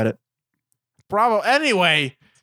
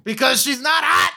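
mocking the movie; 7 semitones higher than usual. Parodying the film's heavy-handed point, high sarcastic shout. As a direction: spell out the obvious at full volume. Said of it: "it's like".

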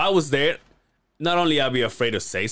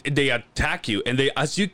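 ordinary delivery

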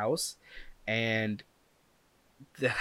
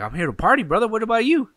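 high, loud hype-man call; loud, pumped-up bro greeting; hyped party greeting; 10 semitones higher, 8 dB louder, faster than usual. Retold or imitated. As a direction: greet him like you came to rage. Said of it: "And I'm like".

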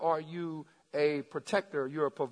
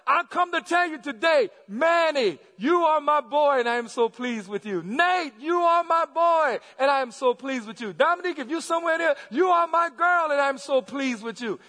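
raised preaching voice, stretched on each declaration; proud public proclamation; 8 semitones higher, 5 dB louder than usual. Real, not acted.

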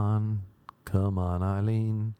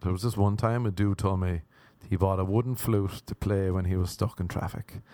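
usual speaking voice

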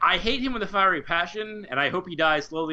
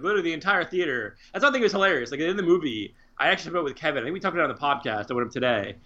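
ordinary delivery

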